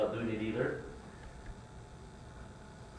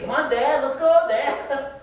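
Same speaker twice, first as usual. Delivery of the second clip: put-on eager kids; 11 semitones higher, 7 dB louder, slower than usual. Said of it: "they're waiting".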